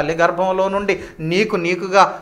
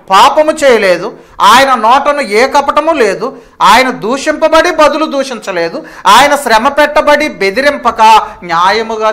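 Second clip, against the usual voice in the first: loud, high scripture proclamation; full-force preaching; 7 semitones higher, 11 dB louder than usual. Acted. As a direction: hammer out each clause at full voice. (పూర్తి బలంతో, హెచ్చు స్వరంతో ప్రసంగం)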